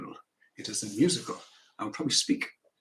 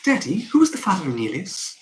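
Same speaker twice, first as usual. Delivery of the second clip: put-on loud, higher-pitched child to daddy; raised-pitch child's question; 6 semitones higher, 7 dB louder than usual. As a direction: pipe up loudly as a curious child. Acting child's line.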